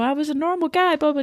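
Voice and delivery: high, mocking whine; Randall bleating. Captive pleading his case to captors, bleating. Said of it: "Randall's bleating all this stuff".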